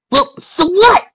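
loud, high-pitched brush-off; high, loud dismissive retort. A narrator's reading. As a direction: toss it out loudly as Rainbow, dismissively. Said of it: "Rainbow said dismissively".

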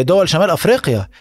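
ordinary talk

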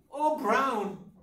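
high, silly frightened exclamation; mock frightened cry. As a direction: squeal it like a helpless Victorian heroine. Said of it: "in a frightened voice".

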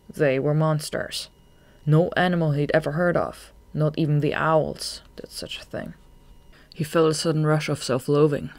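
ordinary delivery, nothing marked

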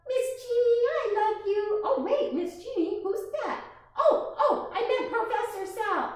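put-on high, eager puppet child; a sweet little puppet getting names mixed up; squeaky kid puppet flustered. Puppet talks to storyteller.

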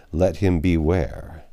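plain reading voice

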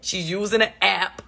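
ordinary talk, nothing marked

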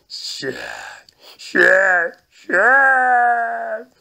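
rising high, loud eerie hiss-call; a ghost's spooky chant, rising; evil ghost voice. Acted. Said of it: "voz maligna de Bilitas".

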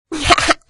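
high, mocking laugh; Kinderlachen. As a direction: laugh like teasing children. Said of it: "lachten die Kinder". (hohes, spöttisches Kinderlachen)

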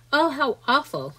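put-on a child's dismayed exclamation, understated; shocked cry. A narrator's reading. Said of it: "cried James".

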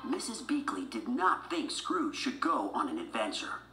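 ordinary delivery

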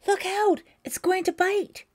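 high, loud frightened shout; terrified warning. A narrator's reading. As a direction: scream a warning from the top bunk.

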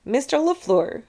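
plain reading voice

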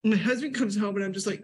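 ordinary delivery

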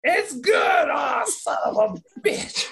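high, slow, drawn-out exasperated cry; mock announcer outburst. Joking about a bad flag, slow high exasperated cry. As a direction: stretch it out like a fed-up commentator.

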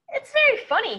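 high, loud excited start; very funny. A narrator's reading. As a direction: burst out brightly. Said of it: "He was very happy".

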